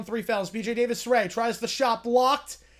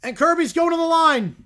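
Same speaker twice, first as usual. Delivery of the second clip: excited play-by-play shout; 5 semitones higher, 7 dB louder, slower than usual. Real, not acted. Live call of a foul.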